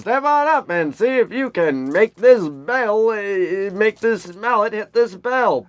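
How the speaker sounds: high, slow carnival barker. Retold or imitated. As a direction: bark it out to a passing crowd. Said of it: "Carnival Barker".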